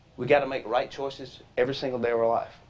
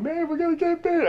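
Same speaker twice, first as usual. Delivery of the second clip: put-on much higher, panicky kid voice; scared little brother; 14 semitones higher than usual. Little brother panicking to older brother, high scared kid voice. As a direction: squeak it out like a terrified kid brother. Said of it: "My little brother's going".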